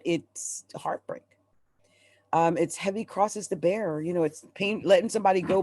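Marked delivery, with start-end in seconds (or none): 0.0-1.2 breathy whispered aside, slow. Real, not acted. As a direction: drop to a slow hushed whisper over the heartbreak. Heavy heartbreak card, whispered.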